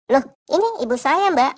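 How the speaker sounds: high, loud indignant protest. Acted. Young man to the receptionist, loud indignant protest. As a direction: object as if wrongly accused. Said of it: "si cowok muda protes".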